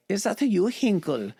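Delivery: high, hammed-up German accent question. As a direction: squeak it out like a startled German soldier. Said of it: "But in German, he's like".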